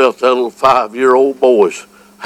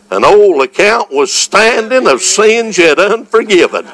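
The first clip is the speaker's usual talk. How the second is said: ordinary talk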